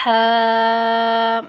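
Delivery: ہا with h from the throat, held long; thin, sustained حا for ہا. Shown as a demonstration. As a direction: stretch ہا as a thin pharyngeal "haaa". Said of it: "حلق سے آواز نکالنی ہے".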